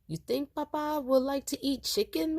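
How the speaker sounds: put-on high, hopeful child voice. A narrator's reading. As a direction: ask like a small boy. Boy asking about father.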